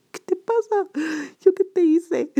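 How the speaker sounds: más aguda y fuerte, indignada; grítale ofendida qué te pasa; reclamo enojado. Retold or imitated. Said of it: "eso me cabreó". (reclamándole indignada al chico grosero, a gritos)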